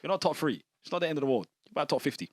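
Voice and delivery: ordinary delivery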